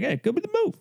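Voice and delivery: too high. Shown as a demonstration. Showing how he says the catchphrase, too high. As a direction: pitch jumps way up, a bit try-hard. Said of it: "my voice kinda goes up a little too high".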